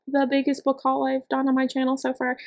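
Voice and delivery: "so far" held high like a question; questioning tune. Real, not acted. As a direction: say "the biggest book haul" high, trailing into an unsure "so far". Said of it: "I say that like a question".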